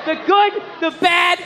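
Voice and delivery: usual speaking voice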